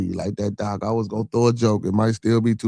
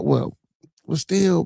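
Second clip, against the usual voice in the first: usual speaking voice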